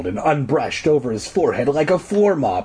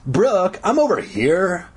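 raised-pitch call from behind; 7 semitones higher than usual. A narrator's reading. Zeke calls to his friend from behind, calling out. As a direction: call out to get her attention. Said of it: "Zeke called out".

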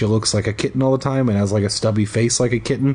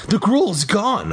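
crying out; 8 semitones higher, slower than usual. Acted. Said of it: "I cried".

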